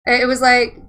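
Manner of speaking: ordinary talk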